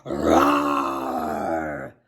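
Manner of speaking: deep, slow growling roar; ferocious roar. Acted. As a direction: roar it out like a beast. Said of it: "he let out a loud".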